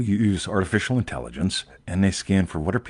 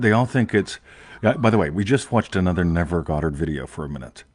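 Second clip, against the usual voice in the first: ordinary talk, nothing marked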